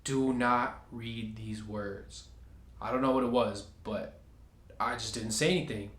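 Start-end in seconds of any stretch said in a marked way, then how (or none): 0.0-2.3 very slow, measured warning; slow, ominous inner voice. Retold or imitated. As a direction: drag each word out like an omen. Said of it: "Something in my mind just told me".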